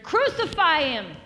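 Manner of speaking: loud, high crowd cry; crowd's shout. Acted. Crowd answering Pilate about Jesus, loud shout. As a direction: call it out like a crowd demanding death. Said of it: "They all answered".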